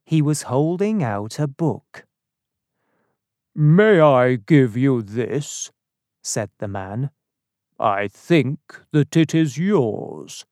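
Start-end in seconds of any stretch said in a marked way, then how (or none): 3.5-5.7 slow, projected, deliberate offer; polite stranger. A narrator's reading. Strange man hands Dunning a book, slow and deliberate. 7.8-10.4 slow, measured stranger's voice; polite stranger. A narrator's reading. Strange man returns Dunning's book, slow and deliberate.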